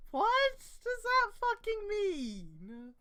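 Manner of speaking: high, slow, baffled outrage; exasperated disbelief. Real, not acted. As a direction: drag it out in disbelief. Baffled by the story's wording.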